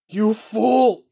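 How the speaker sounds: high, loud furious shout. Acted. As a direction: scream the insult at her. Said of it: "she shouts at Parker".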